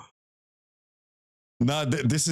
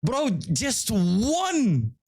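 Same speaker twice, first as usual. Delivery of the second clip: drawn-out high angry yell; furious shout; 11 semitones higher, much slower than usual. Real, not acted. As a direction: yell out in outrage. Killed by a brute.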